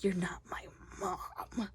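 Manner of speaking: slow, drawn-out whisper; hushed retort. Retold or imitated. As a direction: whisper the hurtful line very slowly. Retold fight, daughter rejects mother.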